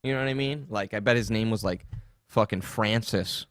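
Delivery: usual speaking voice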